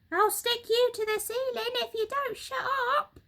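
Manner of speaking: high, sharp impatient snap; snapped threat. Acted. Mugglewump snapping at his small son, sharp threat. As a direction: cut the child off. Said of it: "snapped Mugglewump".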